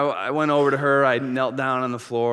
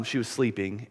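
ordinary delivery

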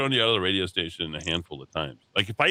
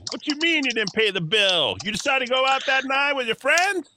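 shrill angry partner. Acted. Acting out a domestic dispute.

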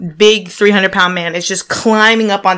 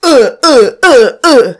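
loud effortful grunts; grunting sounds; 5 semitones higher, 6 dB louder than usual. Retold or imitated. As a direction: grunt like a wrestler. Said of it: "slapping and like".

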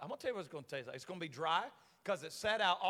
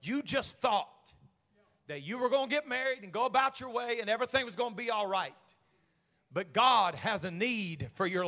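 booming angel; 3 semitones higher, 10 dB louder than usual. Acted. Angel proclaiming God's plan to Mary, booming shout. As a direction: proclaim it like a heavenly herald.

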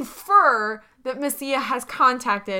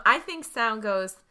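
ordinary delivery

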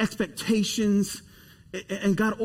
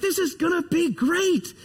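eager confidence; 7 semitones higher than usual. Retold or imitated. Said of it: "I'm like".

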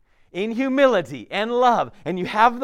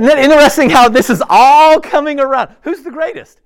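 much higher and much louder, animated; the preacher bursts out to the congregation, much higher and louder; excited preaching; 7 semitones higher, 12 dB louder than usual. Real, not acted. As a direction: burst out with delight at the connection.

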